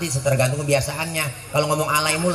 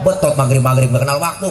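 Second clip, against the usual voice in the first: ordinary talk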